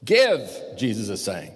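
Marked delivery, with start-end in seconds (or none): 0.0-0.4 Give, shouted higher and louder; loud exclamation of command; exclaimed command. Shown as a demonstration. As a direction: bark it as an order from Jesus. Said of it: "There should be an exclamation mark after the word give".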